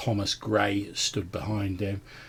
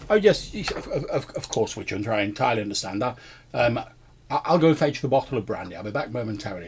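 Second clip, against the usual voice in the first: fawning assistant hurrying to obey; eager servant; 3 semitones higher, 5 dB louder than usual. Acted. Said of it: "and he, he wanders off".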